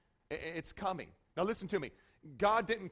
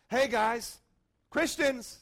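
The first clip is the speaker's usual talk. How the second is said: higher, louder hail to the flock; rallying call; 4 semitones higher, 5 dB louder, slower than usual. Acted. Preacher voices James addressing Christians, rallying call. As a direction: call out to the whole crowd.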